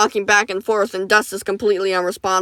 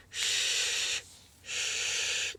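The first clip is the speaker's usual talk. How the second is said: whispered hush; 16 dB quieter than usual. Acted. Doctor hushes screaming patient.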